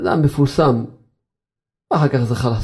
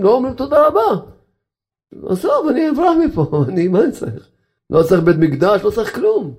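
high, laughing, fed-up complaint; God fed up with the crybabies, high and laughing; God's exasperated voice; 6 semitones higher than usual. Retold or imitated. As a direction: play God throwing up his hands at the whiners. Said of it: "השם יתברך אומר".

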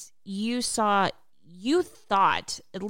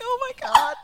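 high squeal of shock; shocked oh my God; 5 dB louder than usual. Retold or imitated. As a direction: gasp it out way up high. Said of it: "I was like".

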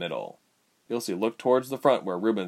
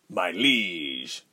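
called with a grin; 2 semitones lower than usual. A narrator's reading. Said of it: "Rubens called with a grin".

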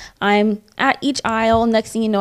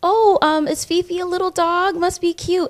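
put-on polite old woman; 8 semitones higher than usual. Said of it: "the old woman's like".

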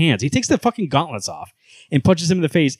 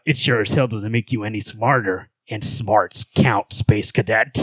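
low, dragged-out sarcastic hero; Batman; 3 semitones lower, slower than usual. Acted. Host insulting Superman as Batman, low voice. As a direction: lower it and stretch the put-down. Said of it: "low voice for Batman".

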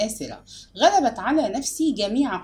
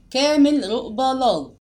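raised, ringing roll call of a name; 3 semitones higher, 4 dB louder than usual. A narrator's reading. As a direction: call the student's name out across the hall. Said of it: "والأستاذ ينادي".

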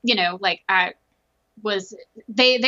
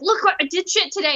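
excited brag; 10 semitones higher than usual. Retold or imitated. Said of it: "so excited and would tell my ex".

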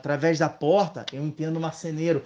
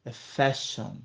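low, deliberate correction, lightly acted; pompous correction with pauses, half-acted; mildly mock-offended; 5 semitones lower, 4 dB quieter than usual. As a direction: imitate an offended manager, understated. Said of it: "ficou ofendido".

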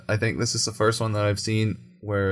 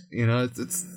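usual speaking voice

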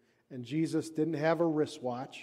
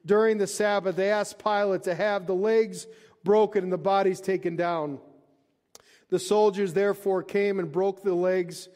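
raised, projected scripture reading; 4 semitones higher, 5 dB louder than usual. Acted. Preacher reading the crucifixion account to the congregation, projected scripture reading. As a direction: read it out strongly to the whole room.

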